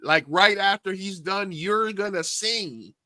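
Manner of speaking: high, slow, drawn-out spelling out; exasperated explaining. Retold or imitated. Host telling the drunk customer she's next, exasperated. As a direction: explain as if to someone not getting it.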